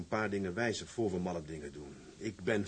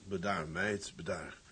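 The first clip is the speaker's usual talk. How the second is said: soothing; 4 semitones lower, 6 dB quieter, slower than usual. Acted. Calming upset girl.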